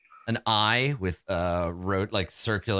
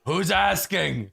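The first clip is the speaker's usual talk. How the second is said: slow, raised creature voice; bugbear challenge; 4 semitones higher, much slower than usual. Acted. Bugbear answers a shout from down the corridor, slow challenging call. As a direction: call back suspiciously, stretching the words. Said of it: "And then you hear".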